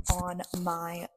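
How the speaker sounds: ordinary talk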